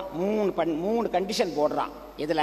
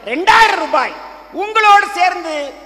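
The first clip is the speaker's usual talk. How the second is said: rousing shout; 9 semitones higher, 12 dB louder than usual. Acted. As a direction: belt it out to the whole crowd. Crowd rouses, priest shouts prices.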